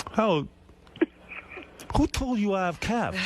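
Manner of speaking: a hint of Gary, offended and defensive; Gary impression. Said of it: "an impression of a great moment in Gary history".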